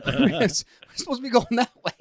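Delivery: high and fast, a mocking impression of the friend; squeaky friend voice. Friend waking up lost in the car, squeaky mock panic. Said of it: "He goes".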